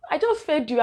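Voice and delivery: high, exasperated "I just fed you"; tired mother quoting herself high and weary. Retold or imitated.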